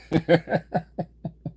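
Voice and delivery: high, slowing chuckle; real chuckle. Real, not acted. Streamer amused by his quick learners jab, chuckling. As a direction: chuckle at your own jab.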